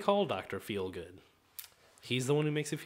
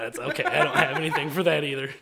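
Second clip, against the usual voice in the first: ordinary talk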